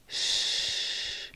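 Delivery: a long breathed sh on its own; just the sh hiss, nothing voiced; isolated sh sound. Shown as a demonstration. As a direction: hold a bare sh like a hiss. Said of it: "It sounds like S H".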